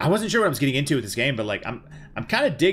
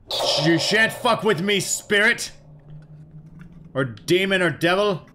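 mock heroic threat; 7 semitones higher, slower than usual. Mock threat to a game spirit.

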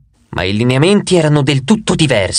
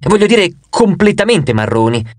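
plain reading voice

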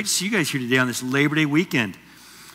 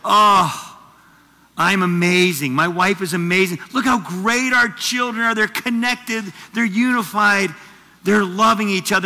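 hammed-up proud boasting, louder; proud parent; 3 semitones higher, 5 dB louder than usual. Parent admiring their calm children, mock self-congratulation. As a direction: gloat over the perfect family. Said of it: "You just think this like".

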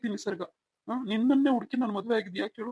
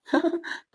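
short, high, disbelieving laugh; nervous laugh; 9 semitones higher than usual. Acted. Husband to wife, a nervous disbelieving laugh.

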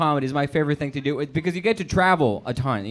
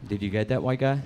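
usual speaking voice

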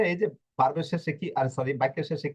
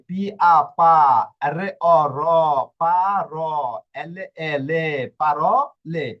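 slow syllable drill; 5 dB louder than usual. Shown as a demonstration. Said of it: "আপনি অনায়াসে উচ্চারণ করতে পারছেন".

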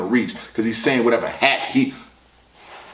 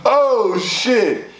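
high, drawn-out amazement; stunned exclamation; 8 semitones higher than usual. Real, not acted. Reviewer reacting to the battle, stunned. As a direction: let the shock stretch the first word.

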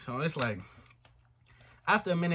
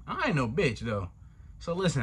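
usual speaking voice

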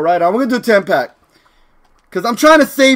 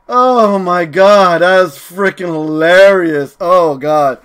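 ordinary talk, nothing marked

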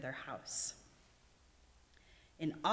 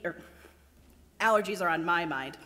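ordinary delivery

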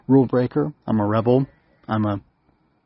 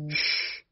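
sharp breathy shush; hushing shh; 7 dB quieter than usual. Acted. Hum hushing the chattering bugs, sharp shush. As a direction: hush them right away. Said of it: "Hum admonished".

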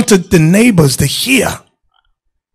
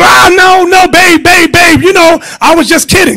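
high, loud panicked begging; pleading husband; 11 semitones higher, 4 dB louder than usual. Retold or imitated. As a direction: beg the wife not to call, in a hammed-up panic. Husband begging wife, acted.

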